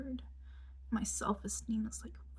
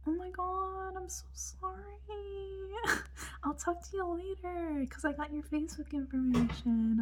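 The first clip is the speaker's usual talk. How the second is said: gushing mock apology; 9 semitones higher, 3 dB quieter than usual. Anne apologizes to the sleepy cellist, high gushing imitation. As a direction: mimic her gushing in a high voice.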